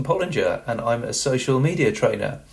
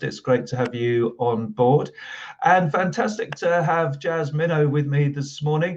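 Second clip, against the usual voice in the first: ordinary delivery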